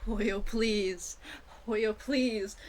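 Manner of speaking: ordinary talk, nothing marked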